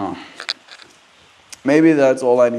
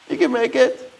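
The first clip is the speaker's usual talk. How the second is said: coaxing the cat; 12 semitones higher than usual. Real, not acted. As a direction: gently coax the kitty up high.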